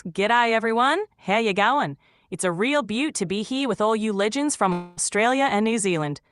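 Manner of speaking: lively, broad Australian accent, playing it up; Ocker Aussie accent. Shown as a demonstration. AI greets Australian webinar in its best Ocker Aussie accent. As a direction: greet them like a true-blue Aussie, Ocker twang. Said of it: "put your best Ocker Aussie accent".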